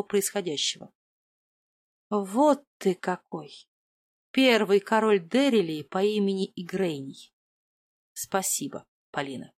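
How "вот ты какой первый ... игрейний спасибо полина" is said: haughty king. Acted. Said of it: "Голос у него оказался глубже и надменнее".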